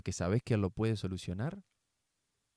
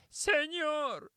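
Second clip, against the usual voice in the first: high beggar voice. Acted. Blind man answers Jesus.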